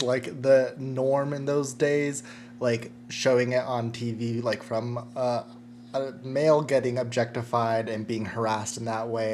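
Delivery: ordinary talk, nothing marked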